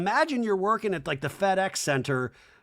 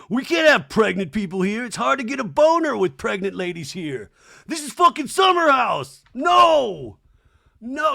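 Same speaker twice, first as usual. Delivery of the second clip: loud, high mock rant; hammed-up indignant yelling; imitated outrage; 7 semitones higher, 7 dB louder than usual. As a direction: build to a high shouted protest. Acting out Jesse's outraged objection.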